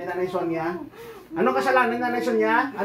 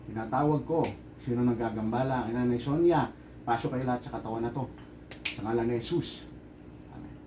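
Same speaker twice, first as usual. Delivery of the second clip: low, subdued invocation; solemn low exorcist's prayer; ritual summoning; 9 semitones lower, 8 dB quieter than usual. Real, not acted. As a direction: summon a spirit in a low, soft voice.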